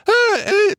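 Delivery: sudden very high squeaky crack; teen boy's cracking squeak. Retold or imitated. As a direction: let the voice break up into a squeak. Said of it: "they have the little squeak".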